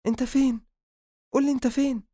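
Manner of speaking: high-pitched alarmed questioning; panicked. Acted. Habiba asks Adham on the phone where he is, panicked. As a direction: demand an answer in a startled panic. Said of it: "وقفت بفزع وسألت".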